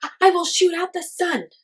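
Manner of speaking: high, loud, stammering threat; furious boy screaming at the crowd; angry yell. A narrator's reading. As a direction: shout the threat with tears of anger. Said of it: "he yelled".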